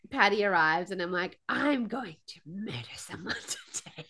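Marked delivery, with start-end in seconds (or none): 1.5-4.1 quietly threaten, half joking, at the end of your patience; softly voiced murderous exasperation; seething mock threat. Retold seething threat to partner.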